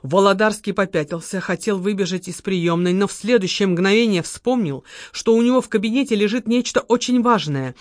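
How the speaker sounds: plain reading voice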